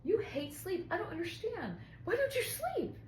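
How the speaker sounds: high-pitched baffled teasing; playful high-voiced mock protest; mock exasperation. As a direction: imitate a baffled partner, pitch swooping way up. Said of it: "he jokes, he's like".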